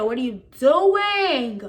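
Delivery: long, drawn-out incredulous question; exasperated. Retold or imitated. Student to staff ignoring her family situation, exasperated. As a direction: stretch it out in exasperation. Fed up with the school's demands.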